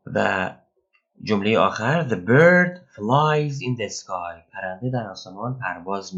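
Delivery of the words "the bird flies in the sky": slow, carefully spaced English; slow example read. Shown as a demonstration. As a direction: read it out slowly for learners. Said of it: "teacher reads example sentence".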